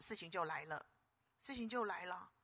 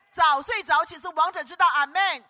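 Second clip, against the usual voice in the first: higher, louder preacher's shout, landing on 道; 道 hit loud at the end of 早睡早起是王者之道; proclaimed motto; 9 semitones higher, 17 dB louder than usual. Real, not acted. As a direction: cry it out to the whole hall. Motto repeated even higher.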